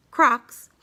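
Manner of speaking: ordinary delivery, nothing marked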